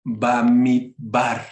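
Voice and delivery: "Bamidbar" very slowly, syllable by syllable; pronunciation demo. Shown as a demonstration. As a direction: sound out "Bamidbar" as halting beginner's Hebrew. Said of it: "se pronunciaría algo así como".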